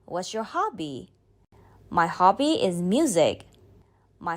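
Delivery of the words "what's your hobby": normal talking voice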